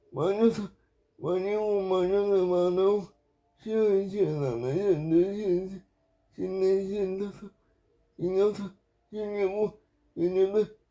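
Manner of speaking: strained counting while doing crunches; counting through head lifts. Real, not acted. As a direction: count reps under strain, breath short. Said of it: "머리 들기 운동인데요".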